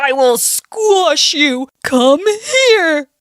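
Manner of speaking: enraged storybook bear after a bee; angrier bear. Acted. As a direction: roar it as a hammed-up threat. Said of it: "Mister Bear gets angrier and angrier".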